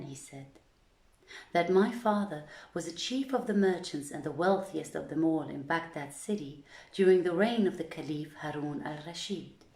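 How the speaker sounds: ordinary delivery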